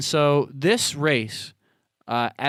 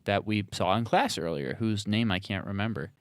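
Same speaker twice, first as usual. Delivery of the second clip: normal talking voice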